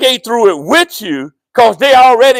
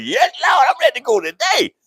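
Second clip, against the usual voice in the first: mock eager shout; 5 semitones higher than usual. Congregation imitated as eager.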